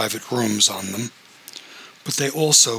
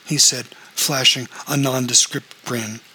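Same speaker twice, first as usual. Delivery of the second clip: ordinary delivery, nothing marked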